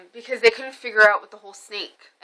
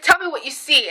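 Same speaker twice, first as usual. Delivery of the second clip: forceful; 6 semitones higher, 4 dB louder than usual. Retold or imitated. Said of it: "This woman is forceful".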